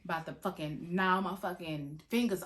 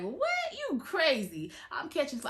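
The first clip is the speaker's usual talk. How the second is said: jumps way higher on What, mocking disbelief; incredulous boyfriend; 8 semitones higher than usual. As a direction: imitate his shocked brush-off. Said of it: "He like".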